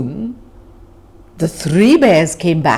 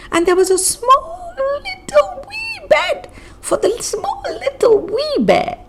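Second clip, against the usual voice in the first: much higher, squeaky little voice; tiny wee voice. A narrator's reading. Narrator describing the wee bear in a tiny high voice. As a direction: shrink your voice for the wee bear.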